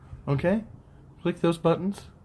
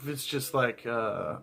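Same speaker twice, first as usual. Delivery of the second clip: ordinary talk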